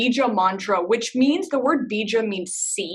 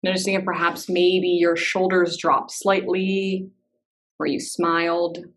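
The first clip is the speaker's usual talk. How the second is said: unhurried meditation guide with long pauses; calm, spacious instructions, leaving silence between phrases; guided meditation. Real, not acted. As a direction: ease listeners gently into body awareness.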